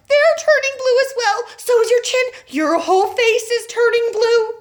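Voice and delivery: high, loud frantic cry; a mother losing control; panicked mother. A narrator's reading.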